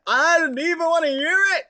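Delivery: high, exaggerated huffy dismissal; playful mock indignation; indignant. Real, not acted. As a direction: wave them off in mock outrage. Dismissing chat's correction.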